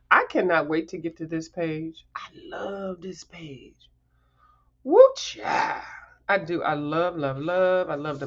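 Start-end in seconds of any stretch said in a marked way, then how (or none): 2.1-3.9 slow breathy whisper; whispered love. Real, not acted. Gazing at her favourite page, she whispers softly. Excited about a coloring page. 4.8-5.8 high, loud gleeful whoop; excited whoop. Real, not acted. Thrilled by the page, she whoops to viewers.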